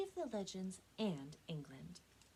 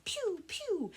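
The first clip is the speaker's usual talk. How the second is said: arrow sound effect; 8 dB louder than usual. Acted. Imitating arrow shots in story.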